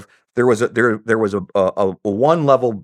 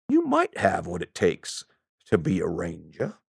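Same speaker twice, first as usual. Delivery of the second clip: put-on Southern accent; 5 semitones lower, 8 dB quieter than usual. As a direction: draw it out like an old Southerner. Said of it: "I don't have a very good Southern accent".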